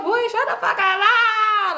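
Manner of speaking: high, giggly mock scolding; imitated laughing retort. Friend flirtatiously brushes off his talk, imitated laughing squeal. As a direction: squeal it playfully and break into laughter. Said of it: "like, just laughing".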